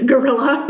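laughing while repeating "Gorilla?"; "Gorilla?" said high in mock disbelief; mocking mispronunciation.

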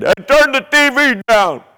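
imitated mother yelling, high and loud; sudden high-pitched parental shout; mom's yell. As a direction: holler at the kid like an exasperated mom. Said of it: "the mom yells at the kid".

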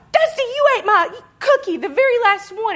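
loud, very high hammed-up yell; mock furious accusation. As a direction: shriek the accusation in comic outrage. Acted accusation over the stolen cookie.

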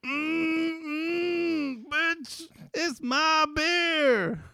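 high, slow, drawn-out sassy claim; sassy mock diva. To co-host about their exclusive beer, high sassy diva voice. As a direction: camp it up, guarding your beer. Hammed-up possessive beer owner.

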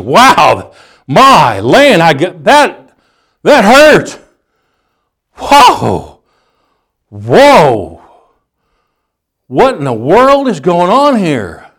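high, drawn-out stunned exclamations; dazed boxer. Acted. Preacher acting himself knocked down by Mike Tyson, dazed. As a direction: reel from the punch, swooping up in pitch.

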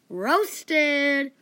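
loud, drawn-out taunt; gloating taunt. Acted. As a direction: crow it like a hype man. Mock taunt after an insult.

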